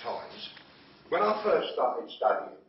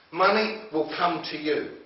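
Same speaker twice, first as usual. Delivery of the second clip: usual speaking voice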